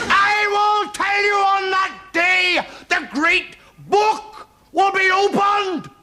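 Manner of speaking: high, slow, loud ranting preacher; fire and brimstone preacher. Acted. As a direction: thunder it from the pulpit, dragging each word. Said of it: "fire and brimstone preachers".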